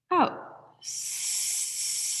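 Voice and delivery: long hissing S breath out; exhale on S. Shown as a demonstration. Voice teacher demonstrating breathing out on S. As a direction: let the air out on a steady S. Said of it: "I let that out on an S sound".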